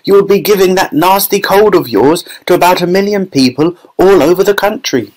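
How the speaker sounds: high-pitched scolding mother; high maternal reproach; Missus Gloop. A narrator's reading. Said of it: "shouted Missus Gloop".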